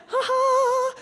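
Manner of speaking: high sing-out reveal with a gleeful "ha ha". Retold or imitated. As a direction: build to a giddy, high-pitched reveal. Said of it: "getting more and more excited".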